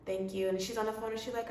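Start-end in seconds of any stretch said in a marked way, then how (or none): 0.0-0.5 soft, deflated thanks; sad girl mode. Retold or imitated. To the agent after rejection, quiet deflated sadness. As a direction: swallow the disappointment. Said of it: "my sad girl mode".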